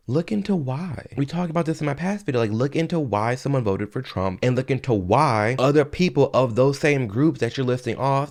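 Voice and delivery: ordinary talk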